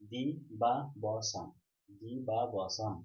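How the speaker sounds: দি বা ব সান in four separate beats; syllable by syllable. Shown as a demonstration. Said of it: "এভাবে উচ্চারণ করছি তো".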